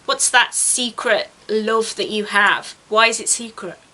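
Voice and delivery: usual speaking voice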